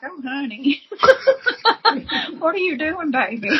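teasing, laughing "oh honey"; mock street listener. Street crowd mocking her bad singing, teasing. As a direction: mimic a pitying listener. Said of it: "they'd be like".

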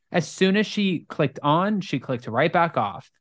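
ordinary talk, nothing marked

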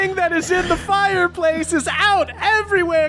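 high excited goon voice; Petty Jack voice. Acted. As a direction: babble it high and amazed. Goon voice kept in banter.

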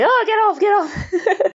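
high-pitched mock alarm, half laughing; mock panic. Dreamer retelling the lady being grabbed, mock panic. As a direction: imitate someone panicking, pitched well up. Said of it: "sort of panicking".

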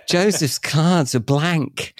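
slow and high through laughter; laughing. Real, not acted. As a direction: laugh out each word slowly. Laughing at his own thought.

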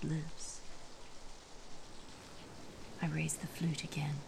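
hushed whispering storyteller; whispered narration. A narrator's reading. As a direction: whisper the story close to the mic.

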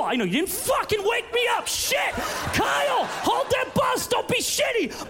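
high, shrill panicked mom screaming at her son; stay-at-home mom yelling at her son who missed the bus, panicked shout; frantic mom yelling. Acted.

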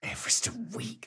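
high-pitched, hushed disbelief; astonished. Real, not acted. Co-host reacts to the colleague's huge climbing target, astonished. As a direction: query it in a high, incredulous whisper.

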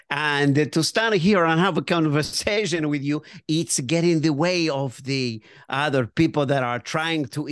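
put-on deliberate Italian waiter accent. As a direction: explain politely in an Italian accent. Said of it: "with the great Italian accent you have".

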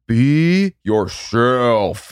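slow, stretched, put-on accent; dad's silly voice. Imitating dad's goofy advice to his kid, slow and stretched. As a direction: drag out each word like a goofy dad. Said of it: "he would always say it in this silly voice".

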